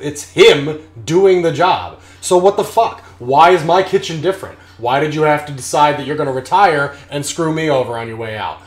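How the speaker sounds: loud, high outraged yelling; angry yelling. Real, not acted. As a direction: yell at the scammer as if he were here. Ranting at absent contractor.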